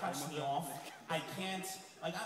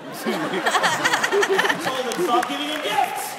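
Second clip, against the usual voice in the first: laughing, loud and slowed; hammed-up exasperation at girlfriend; loud laughing retelling; 8 semitones higher, 11 dB louder, much slower than usual. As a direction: belt it out through laughter. Retelling girlfriend honking scene.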